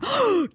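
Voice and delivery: sharp high mock gasp of outrage; offended gasp. Host mocking the outraged woman's offended gasp. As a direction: gasp as if horribly insulted. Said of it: "that type of reaction".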